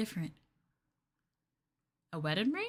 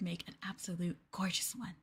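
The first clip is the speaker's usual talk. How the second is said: soft whispered compliment fading away; 6 semitones lower, 6 dB quieter, much faster than usual. Acted. Girlfriend whispering praise to her bound partner. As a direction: murmur it just for him.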